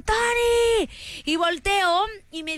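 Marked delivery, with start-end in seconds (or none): 0.0-0.9 imitating a high call across the street; calling her name from afar, mock shout; retold call out. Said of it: "escucho que me gritan". (imitando un grito alto: "¡Tony!")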